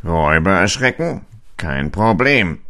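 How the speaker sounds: a cocky fox shrugging it off; lässiger Fuchs; confident fox. A narrator's reading. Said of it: "sagte der Fuchs".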